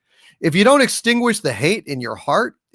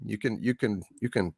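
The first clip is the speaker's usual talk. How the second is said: muttered; 7 semitones lower, 11 dB quieter than usual. Real, not acted. Mutters a catchphrase quietly.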